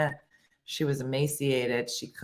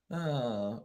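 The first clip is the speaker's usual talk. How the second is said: ordinary delivery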